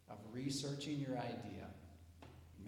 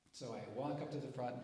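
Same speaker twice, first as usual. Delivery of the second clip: ordinary delivery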